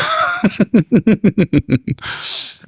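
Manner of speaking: high laugh. Real, not acted. Laughs after hang-up story.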